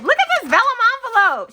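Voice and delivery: excited squeal. Real, not acted. Delighted unboxing of gift.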